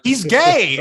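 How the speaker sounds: mock sassy quip. Mimicking the film character's quip about the shoes. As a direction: play the sassy one-liner. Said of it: "she's just like".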